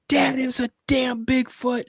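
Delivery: put-on high, panicked kid yelling for his dad; retold yell. Boy yelling to his dad about Bigfoot, frantic high yell. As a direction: shout it up high like a scared boy. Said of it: "I'm, I'm yelling".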